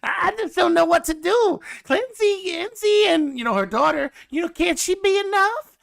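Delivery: prim southern lady voice, way up high; southern belle. Retold or imitated. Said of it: "her southern accents".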